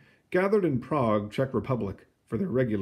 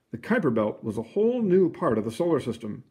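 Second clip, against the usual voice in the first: usual speaking voice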